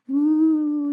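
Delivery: high, drawn-out ghostly hoot; spooky bat whoo. Acted. Storyteller showing the bat in the boy's room, spooky hoot. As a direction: swoop a haunting "whoo".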